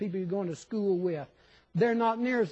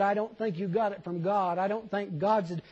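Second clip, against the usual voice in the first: normal talking voice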